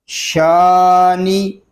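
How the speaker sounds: شانی slow, the شا stretched one alif; lengthened vowel. Shown as a demonstration. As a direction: draw out the شا of شانی. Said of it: "ایک الف تک کھینچ کر پڑھیں گے". (شا کو کھینچ کر شانی)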